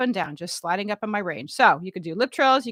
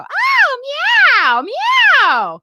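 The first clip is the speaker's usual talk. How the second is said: high, loud cat-like meow; cooing at kitties; 11 dB louder, much slower than usual. Retold or imitated. High loud meow to kittens. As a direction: she coos at pretty kitties on screen. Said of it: "look at all the pretty kitties and go".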